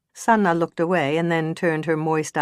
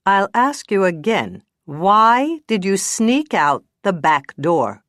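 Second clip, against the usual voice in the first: louder, slow, hard repeat; bad cop repeating; 4 semitones higher, 5 dB louder, slower than usual. Bad cop repeating the question slowly to the witness. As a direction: hammer the question again. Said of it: "Time for the bad cop".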